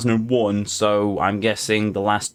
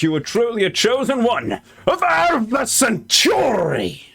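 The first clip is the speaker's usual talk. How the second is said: high, drawn-out hammy shout; grand proclamation; 11 semitones higher than usual. Acted. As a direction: build to a booming mock-heroic announcement. Proclaiming the game's chosen one.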